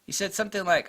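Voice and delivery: ordinary talk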